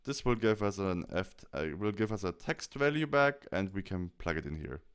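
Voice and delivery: ordinary delivery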